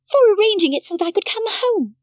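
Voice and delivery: put-on a grateful child explaining; Heidi's voice. A narrator's reading. Heidi tells the doctor why she is grateful, high childlike.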